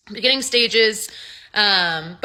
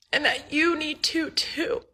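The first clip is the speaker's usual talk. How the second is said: choking up; 5 semitones higher, 4 dB quieter, slower than usual. Real, not acted. Patient passing on Jason's message, teller choking up. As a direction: voice rising and fading away.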